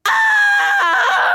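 very high, loud, long wavering scream; cartoon plummet yell; falling turtle scream. A narrator's reading. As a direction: scream it all the way down. Turtle flies in last.

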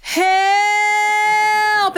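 high, sustained comic wail; imitating someone carried away; calling for help. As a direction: stretch it out on one high note. Uncle's third call from the river.

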